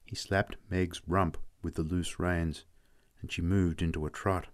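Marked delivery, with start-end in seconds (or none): none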